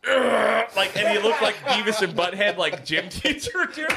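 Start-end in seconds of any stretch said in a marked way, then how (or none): 0.1-0.7 loud, high strained growl in imitation; furious growl. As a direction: mimic a man bursting with rage. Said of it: "his fucking veins are popping".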